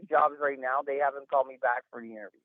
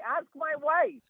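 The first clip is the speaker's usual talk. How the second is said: ordinary talk, nothing marked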